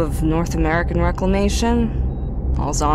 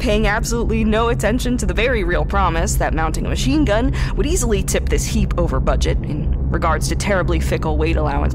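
usual speaking voice

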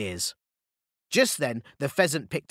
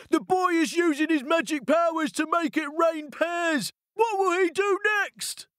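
very high panicked shouting; frightened howl; 13 semitones higher than usual. A narrator's reading. Ogres fleeing Momotaro's magic, terrified howl. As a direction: wail it in terror. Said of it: "ran away howling".

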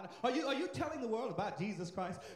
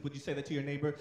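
ordinary talk, nothing marked

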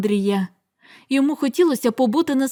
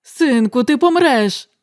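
exclaiming; 5 dB louder, slower than usual. Acted. Father crying out to his son. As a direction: cry out after him. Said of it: "вигукнув Артур".

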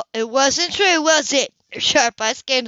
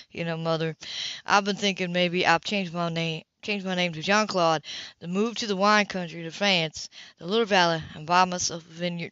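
softer, lower teasing man; lightly voiced Philip; 5 semitones lower, 5 dB quieter than usual. Philip teases Mother with a vineyard fantasy, soft. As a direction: spin the daydream gently.

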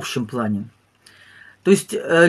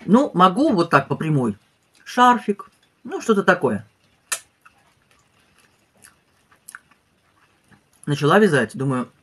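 speaking between bites; talking while chewing; 3 dB quieter than usual. Real, not acted. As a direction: mouth partly full. Seen 0: Bite taken near -1s; she chews while speaking, then takes another bite.

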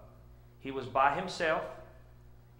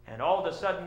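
usual speaking voice

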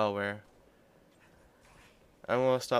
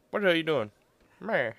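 fiance's dad; 7 semitones higher, 4 dB louder, faster than usual. Retold or imitated. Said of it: "see him walking up to me going".

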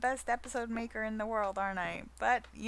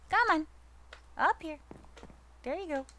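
higher coaxing call to a pet; 6 semitones higher, faster than usual. Real, not acted. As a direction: coax the dog up. Calling the pet.

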